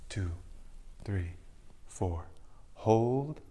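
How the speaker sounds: soft, sinking counts with the cue words lifted; guided breath counting. Acted. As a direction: count the breaths calmly, letting each number fall away. Leads a breathing meditation.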